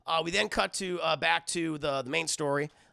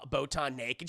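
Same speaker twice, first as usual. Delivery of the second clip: ordinary talk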